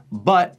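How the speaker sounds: "but" with the vowel of foot, short U; northern "but" said like "put". Shown as a demonstration. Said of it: "but would be but".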